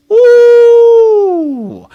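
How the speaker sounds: ooh agudo e excitado; a rising thrilled ooh; excited ooh. Real, not acted. Reacting to clip drama.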